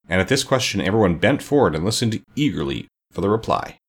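usual speaking voice

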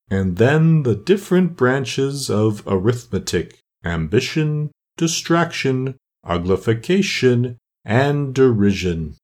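very slow, long-drawn vowels; doleful turtle. Acted. Mock Turtle lists his lessons for Alice, slow and doleful. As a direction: stretch each subject out ponderously.

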